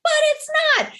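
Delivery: mock-offended objection; indignant retort. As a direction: snap back that it isn't the same. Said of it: "And you're like".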